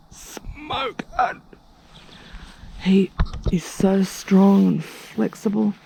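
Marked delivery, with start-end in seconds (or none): 0.1-1.4 high soft swooning squeal; breathy swoon. Real, not acted. Vlogger gushing over the hot yoga teacher, high swoon.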